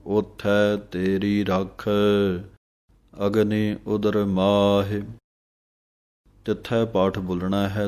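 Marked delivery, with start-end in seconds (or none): 0.0-5.0 measured, drawn-out recitation of gurbani; slow devotional recitation; slow scripture recitation. Shown as a demonstration. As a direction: recite each word slowly and clearly. Recites scripture line slowly.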